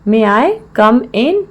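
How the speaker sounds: slow, word-by-word teaching delivery; slow repeat. Shown as a demonstration. Teacher repeats the English phrase for learners, slowly.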